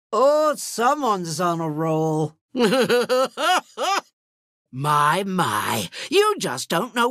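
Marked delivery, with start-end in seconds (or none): 2.5-4.0 villain chuckle. Acted. Sea witch mocking someone on a roll, villain chuckle. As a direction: chuckle climbing in pitch.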